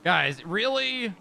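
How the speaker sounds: drawn-out disbelieving really; slow, fed-up question; exasperated. Real, not acted. Exasperated at the arrest.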